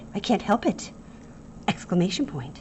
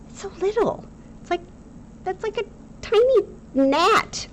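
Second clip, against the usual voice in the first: much higher, squeaky for something tiny; tiny-voice cuteness; 14 semitones higher than usual. Pretend. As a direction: play up how tiny it is. Marvelling at a tiny period.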